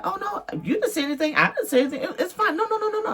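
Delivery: high, quick, flustered neighbor reassuring; neighbor's high voice. Retold or imitated. Imitating the neighbor waving off her apology, high and hurried. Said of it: "He was like".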